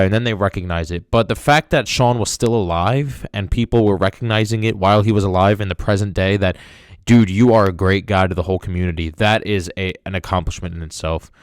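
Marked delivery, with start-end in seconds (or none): none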